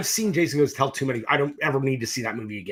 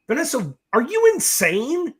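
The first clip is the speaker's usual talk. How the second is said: incredulous; 9 semitones higher than usual. Retold or imitated. Incredulous retort to producer.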